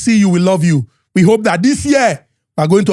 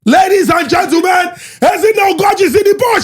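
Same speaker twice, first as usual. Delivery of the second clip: hype announcer; 11 semitones higher than usual. Acted. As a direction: belt out a grand introduction, stretching the ending. Hype introduction of co-host.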